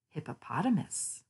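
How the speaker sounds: usual speaking voice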